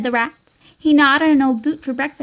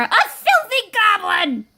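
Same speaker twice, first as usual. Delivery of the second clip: high, drawn-out outcry; disgusted shriek; 8 semitones higher, slower than usual. Acted. Farmer recoiling from a filthy goblin, high disgusted shriek. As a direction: cry out in horror, stretching each word. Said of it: "shrieked the farmer".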